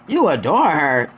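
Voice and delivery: slow, swooping "adore" full of doubt; retold skeptical echo; disbelieving question. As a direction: repeat it back slowly as if you don't buy it. Garcelle doubting Sutton.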